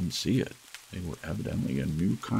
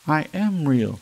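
higher, louder insisting "I am real"; loud protest; 9 semitones higher, 10 dB louder than usual. A narrator's reading. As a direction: shout it back in protest. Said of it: "said the little rabbit".

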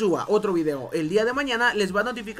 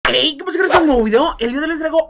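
excited shout; 5 semitones higher, 9 dB louder, faster than usual. Acted. Loud YouTuber intro greeting.

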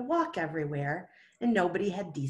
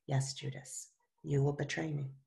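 soft, low, almost breathed reply; Jesus answering Judas softly and gently; gentle; 3 semitones lower, 5 dB quieter than usual. Acted. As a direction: break it to him gently and softly. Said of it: "Jesus gently replied".

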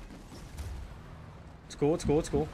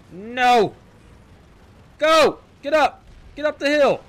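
high, loud urgent yells; exasperated shouting; 10 semitones higher, 8 dB louder, slower than usual. Real, not acted. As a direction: yell at a machine that won't climb. Urging stalled vehicle up hill.

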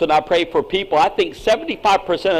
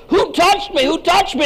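high, urgent questioning; excited Jesus asking who touched him; 12 semitones higher than usual. Retold or imitated. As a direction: call out to the crowd, searching.

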